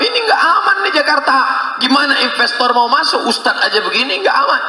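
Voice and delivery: high, fast fretting voice; mock worried critics. Mocking critics who call Jakarta unsafe, high fretting. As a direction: mimic the alarmed gossip. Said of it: "orang menyangka".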